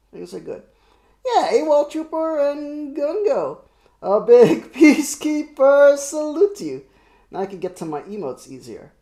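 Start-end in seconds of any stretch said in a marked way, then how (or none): 1.2-6.8 loud, high, manic greeting; crazy voice. Pretend. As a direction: bellow the welcome like a maniac. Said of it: "That's my crazy voice".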